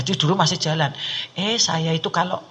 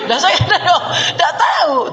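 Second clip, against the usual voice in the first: laughing; 10 semitones higher, 4 dB louder than usual. Real, not acted.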